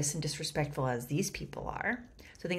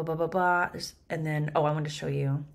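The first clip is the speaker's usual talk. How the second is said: ordinary talk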